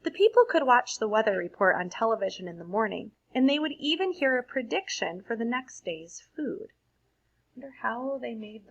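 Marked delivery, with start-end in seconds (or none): 0.0-6.7 put-on lively read-aloud for children; expressive storybook reading to a class; story narration. A narrator's reading. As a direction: read it like a bedtime picture book. Reads picture book aloud.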